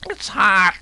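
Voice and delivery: a parrot squawking a warning; parrot yelling. Acted. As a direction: cry it out up high like a startled parrot. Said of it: "that crazy parrot began to yell".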